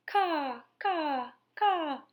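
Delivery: high mocking caws; crow's caw. A narrator's reading. Edward imagining laughing as a crow, high caws. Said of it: "laughed at the man".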